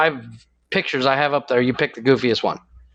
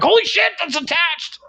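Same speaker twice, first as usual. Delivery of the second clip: much higher, startled cry of pain; shocked yelp. Retold or imitated. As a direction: yelp as if bitten out of nowhere. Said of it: "and I'm like".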